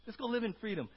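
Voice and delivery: lifted, emphatic voice of Jesus reassuring; Jesus speaking freedom over them; voicing Jesus. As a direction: preach Jesus' words up high and strong. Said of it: "Jesus says".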